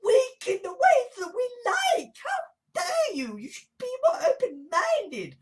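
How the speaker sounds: high-pitched, whiny mock outrage; mock indignation. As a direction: put on a high, wailing voice of the offended. Mimicking indignant people, high put-on voice.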